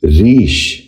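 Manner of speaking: ریش said "reesh", with a long "ee"; correct pronunciation. Shown as a demonstration. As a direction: give ریش a long "ee" before sh. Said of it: "ذہن نشین کر لیجئے".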